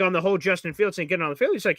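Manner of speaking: normal talking voice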